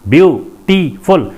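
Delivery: Beautiful in three slow, separate beats; syllable by syllable. Shown as a demonstration. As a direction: count out the syllables of beautiful: view, टी, फुल. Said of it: "इसमें तीन syllable हैं". (Beautiful के तीनों syllable अलग-अलग, धीरे बोलकर)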